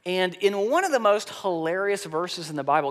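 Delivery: usual speaking voice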